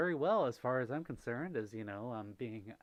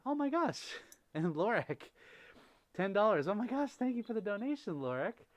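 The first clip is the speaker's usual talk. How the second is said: excited; 8 semitones higher, 5 dB louder than usual. Real, not acted. Surprise donation arrives.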